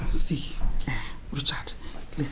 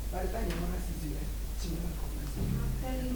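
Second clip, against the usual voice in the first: softer, quick hushed promise; confiding hush; 12 dB quieter, much faster than usual. Acted. Mother-in-law quietly promises the bride a change.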